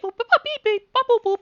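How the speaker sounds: high chirpy robot beeping; robot beeps. Acted. GM voicing the stuck lawn bot, high robot beeps. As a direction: chirp it like a little machine.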